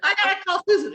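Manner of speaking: high, loud laughing re-enactment; urgent mock plea. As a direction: act out a desperate plea, cracking up. Acting out her urgent plea.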